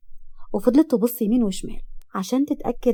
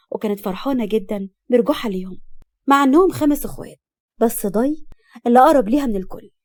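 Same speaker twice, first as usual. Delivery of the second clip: ordinary delivery, nothing marked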